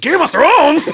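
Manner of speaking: high, loud hammed-up fan outburst; mock crowd going wild; excited tourist shout. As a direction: yell it like an overexcited tourist. Mimics tourists' excited reaction.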